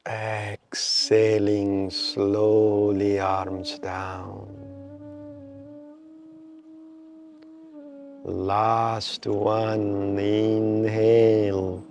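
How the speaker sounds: slow, measured meditation guide with long pauses; guided meditation. Real, not acted. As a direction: draw out inhale and exhale cues to pace the breath. Leads a breathing meditation.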